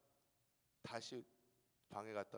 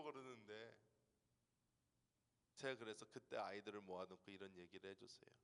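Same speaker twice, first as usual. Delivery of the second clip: ordinary talk, nothing marked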